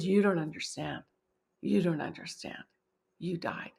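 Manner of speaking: imitating him, low and hushed; subdued, understated retold distress; tearful friend. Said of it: "tears were just streaming down his face".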